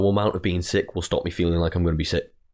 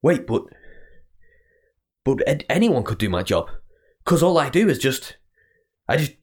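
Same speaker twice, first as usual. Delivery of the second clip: anxious inner voice; 6 semitones higher than usual. Retold or imitated. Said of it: "I have this, like, complex".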